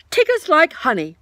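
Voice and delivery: high, loud and certain; decided voice. A narrator's reading. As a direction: state it firmly. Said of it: "in a very decided voice".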